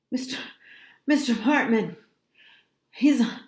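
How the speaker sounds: halting words between pants; panting. A narrator's reading. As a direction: repeat it out of breath, in broken bursts. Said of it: "panting like a dog".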